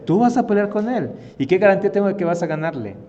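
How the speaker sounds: high, loud incredulous challenge; incredulidad. Acted. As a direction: question him in loud disbelief. Acted king doubting David. (pregunta incrédula, aguda y fuerte)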